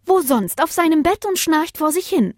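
high, loud wife voice; exasperated wife. Acted. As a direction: answer as if it's obvious. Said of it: "antwortete Lena".

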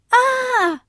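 high sharp scream; boy screaming as a wolf lurks ahead; startled scream. Acted. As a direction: cry out in fright. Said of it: "schrie der Junge".

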